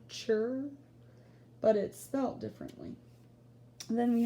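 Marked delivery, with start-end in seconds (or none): 0.1-0.6 "chur" alone, with a light rise; ending sound chur. Shown as a demonstration. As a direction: repeat "chur" to show the same sound. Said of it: "You hear how that makes that same sound".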